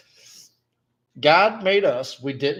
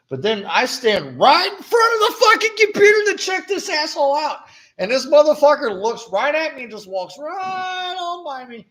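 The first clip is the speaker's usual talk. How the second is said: real frustrated outburst, higher and louder; angry vent; 12 semitones higher, 4 dB louder than usual. Real, not acted. Cashier venting to friend about rude customer, angry raised voice. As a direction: let the anger burst out, raised and loud.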